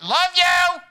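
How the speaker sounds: high, loud, barked love you with a laugh; mock grudging shout. Shown as a demonstration. Preacher hamming up a gruff husband for the congregation. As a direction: yell it like an annoyed husband. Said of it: "It's how we say it".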